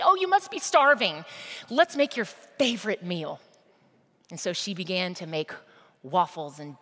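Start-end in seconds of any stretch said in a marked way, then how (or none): 0.0-3.4 high, bright-eyed fuss over a returning son; overjoyed mom welcoming her boy home; delighted mother. Acted. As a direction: gush with relief and joy, pitched up and loud.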